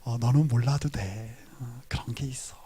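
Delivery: smiling believer answering a curious friend; with a knowing smile. Retold or imitated. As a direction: keep the happy secret with a grin. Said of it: "씩 웃으면서".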